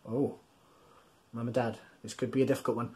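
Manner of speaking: soft, fast confiding aside to the parents; hushed quick aside to grown-ups; quiet aside. Real, not acted. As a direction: drop the voice, quick and conspiratorial.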